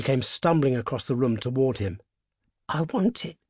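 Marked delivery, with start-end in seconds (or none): none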